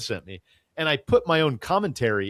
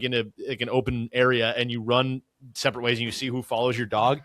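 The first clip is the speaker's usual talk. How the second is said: normal talking voice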